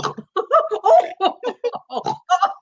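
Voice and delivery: high snorting laugh that falls away. Shown as a demonstration. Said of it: "The snort".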